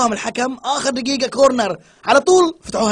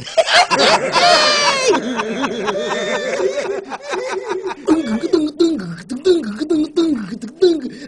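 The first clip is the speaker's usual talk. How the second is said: shouting laughter; 7 semitones higher than usual. Real, not acted.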